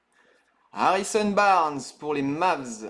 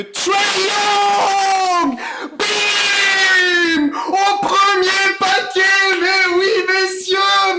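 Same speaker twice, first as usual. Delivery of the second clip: much higher and louder, yelling with joy; ecstatic shouting; 14 semitones higher, 9 dB louder, much slower than usual. Real, not acted. Pulling a rare card live for viewers, ecstatic shouting. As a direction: scream with delight at the big pull. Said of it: "Désolé pour vos oreilles".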